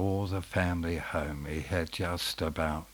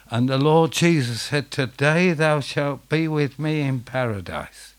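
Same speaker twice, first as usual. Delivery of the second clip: loud, high, ringing preacher voice; a preacher thundering out the good news; preaching at full force; 6 semitones higher, 7 dB louder than usual. Acted. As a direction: build it up and proclaim.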